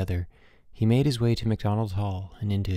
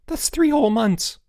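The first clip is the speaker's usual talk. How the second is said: high, louder cry of dismay; exclaiming; 13 semitones higher, 5 dB louder than usual. A narrator's reading. Student realizes how long the punishment lasts, exclaiming in dismay. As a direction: protest it high and aghast. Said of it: "Boots exclaimed".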